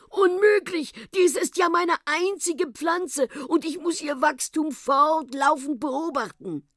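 high, agitated protest; horrified. Acted. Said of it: "rief der Professor entsetzt". (entsetzt und aufgeregt)

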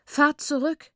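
high, loud anguished cry; screaming mother. Acted. Grieving mother screams at the visitors to go back. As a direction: scream it in despair. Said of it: "schrie sie".

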